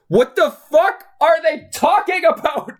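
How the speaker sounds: very high-pitched, incredulous wail; exasperated outburst. Acted. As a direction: cry out in total bafflement, pitch way up. Exasperated outburst over baffling line.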